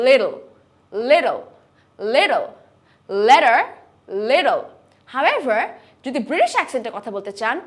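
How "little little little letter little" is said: American flap T. Shown as a demonstration. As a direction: say little as lidl, light flap. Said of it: "This is not little".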